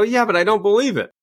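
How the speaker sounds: high, whiny admission; mock doubtful audience. Audience confessing doubt, high and whiny. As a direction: whine it like a caught-out crowd. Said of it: "And they go".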